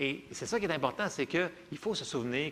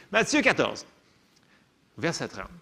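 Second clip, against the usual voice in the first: usual speaking voice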